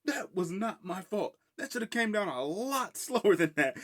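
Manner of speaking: high, slow put-on protest; mock defensive protest. Excusing the car lift's sudden drop, high mock-defensive protest. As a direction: deny blame in a high, hammed-up voice.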